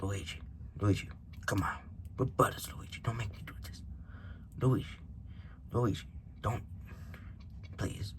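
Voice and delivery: hushed, pleading mafia boss; Mario. Acted. Mafia boss Mario begging his brother Luigi, hushed plea. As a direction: plead under the breath, near whisper, with pauses. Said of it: "you could be like".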